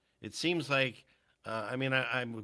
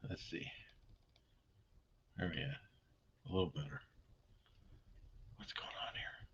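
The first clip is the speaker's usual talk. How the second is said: whispered; 7 semitones lower, 15 dB quieter than usual. Real, not acted. Muttering at a faulty mic.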